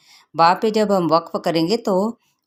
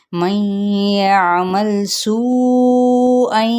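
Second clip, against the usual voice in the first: slow, melodic tajweed recitation, pitched higher and louder; Quran recitation; 6 semitones higher, 4 dB louder, much slower than usual. Acted. Teacher reciting the verse aloud for students, slow melodic chant. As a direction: draw each vowel out in a chanted recitation.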